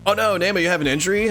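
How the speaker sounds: normal talking voice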